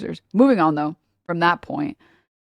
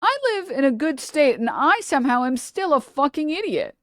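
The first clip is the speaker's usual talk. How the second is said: higher put-on voice of a smug European centrist; mock smug European; 7 semitones higher than usual. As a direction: parody the self-satisfied European. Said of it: "It's like".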